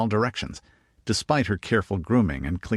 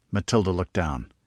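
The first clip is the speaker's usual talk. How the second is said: plain reading voice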